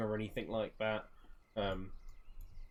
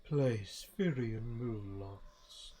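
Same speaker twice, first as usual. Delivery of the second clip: slow, measured character voice; solemn guide figure; slower than usual. Acted. Guide shows Firion the temple, slow solemn voice. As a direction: announce it slowly, with long pauses between phrases.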